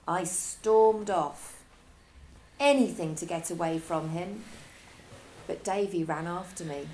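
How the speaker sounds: ordinary delivery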